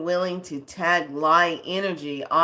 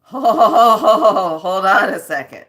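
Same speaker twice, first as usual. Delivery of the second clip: slow and loud, laughing through the words; laughing hold on; 3 semitones higher, 13 dB louder, much slower than usual. Real, not acted. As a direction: crack up while asking to wait. Laughing at weird stuff.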